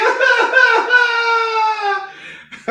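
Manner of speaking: very high, loud theatrical sobbing; high mock sob. Seller mock sobbing about faith. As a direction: break into a hammed-up wail. Mock breakdown after wail.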